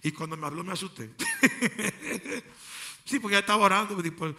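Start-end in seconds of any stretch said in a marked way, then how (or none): 1.2-2.4 a short, high burst of laughter; real amused chuckle. Real, not acted. Laughs at mother's fright.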